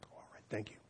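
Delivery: whispered thank you. Retold or imitated. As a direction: breathe it almost silent. Students' meek reply, whispered.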